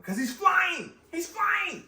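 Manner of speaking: exasperated exclamation. Real, not acted. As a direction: shout it like you can't believe he missed it. Exasperated fan ranting.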